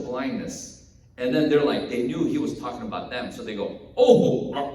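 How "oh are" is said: high, loud affronted disbelief; incredulous. Acted. Pharisees hearing they are spiritually blind, incredulous. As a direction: question it as if you cannot believe your ears. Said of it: "They're just incredulous".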